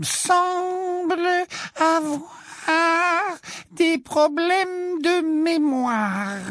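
very high, slow grumble meant to be heard; high slow prim grumble; Umbridge grumbling aloud. A narrator's reading. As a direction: grumble it pointedly for the class. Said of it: "grommela Ombrage suffisamment fort".

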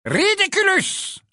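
very high, loud, drawn-out spell; high clear incantation. A narrator's reading. Lupin aims his wand, loud clear spell. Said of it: "il lança haut et clair".

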